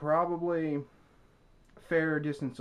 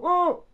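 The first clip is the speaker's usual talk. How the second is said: sudden high-pitched yelp of fright; mock panicked "Oh!"; 8 dB louder than usual. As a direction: squeal it out like a startled man.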